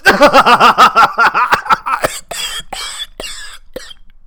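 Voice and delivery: long high maniacal cackle; evil cackle. Acted. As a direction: throw your head back and cackle. Evil laugh after boast.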